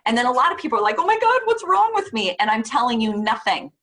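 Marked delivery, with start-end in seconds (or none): 1.0-2.3 high, pitched-up imitation of alarm; mock panic. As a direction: play the worried person lightly. Quoting panicked grieving people.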